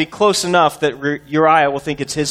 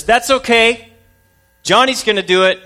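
sister's voice; 7 semitones higher, 3 dB louder than usual. Acted. Sister voiced teasingly in story.

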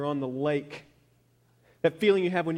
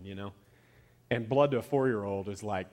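usual speaking voice

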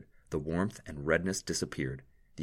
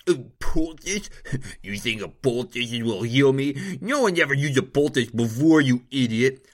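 higher, loud, mocking nobleman with a scoffing laugh; scornful nobleman; 7 semitones higher, 9 dB louder than usual. A narrator's reading. Nobleman scoffing at the poultice, loud and scornful. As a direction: ridicule the doctor's medicine.

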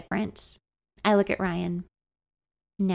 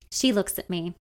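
usual speaking voice

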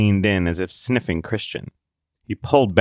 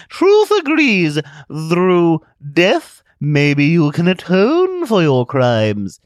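much higher, slow, loud gloating; sinister television; 10 semitones higher, 7 dB louder, much slower than usual. Acted. Television urging Zealot toward death, slow gloating voice. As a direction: gloat like a scheming machine.

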